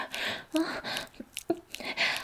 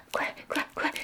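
normal talking voice

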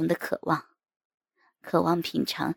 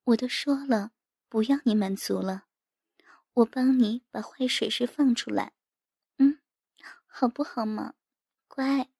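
higher-voiced, wheedling wife; coquettish mock pouting; 6 semitones higher than usual. A narrator's reading. Wife coaxing her husband, coy mock reproach. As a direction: pout and coax like an indulgent wife. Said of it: "妻子娇嗔着".

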